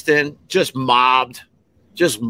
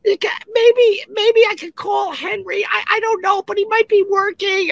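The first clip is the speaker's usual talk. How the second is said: much higher, flustered rambling woman's voice; babbling lady. Retold or imitated. Helper lady babbling on the phone, much higher fretting voice. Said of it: "you hear her babbling".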